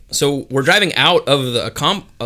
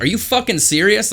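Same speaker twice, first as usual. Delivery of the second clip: pitched-up disbelieving question; retold incredulity; 8 semitones higher than usual. Driver answering passenger's threat, acted incredulity.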